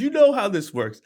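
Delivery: ordinary talk, nothing marked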